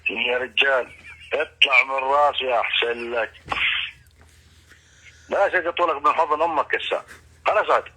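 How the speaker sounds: ordinary delivery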